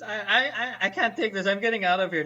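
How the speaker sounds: half-acted distraught walkout; mock tearful protest. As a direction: protest as if crying, lightly played. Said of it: "she stands up crying and says".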